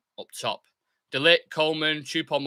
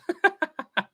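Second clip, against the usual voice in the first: high laugh. Real, not acted. Laughs at his kickoff kit.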